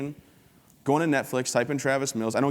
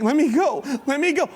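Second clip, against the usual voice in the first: put-on much higher, louder squeal of a boy in pain; hammed-up panicked plea, pitched way up; mock begging squeal; 6 dB louder than usual. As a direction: squeal it like a kid begging to be released. Johnny begs while crushed.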